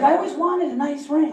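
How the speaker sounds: wife voice. Retold or imitated. Voicing wife.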